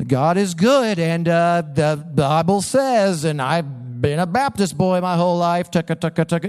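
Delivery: self-mocking pastor fumbling for words; best pastoral answer. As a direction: stall through a stock church answer into patter. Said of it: "my best pastoral answer".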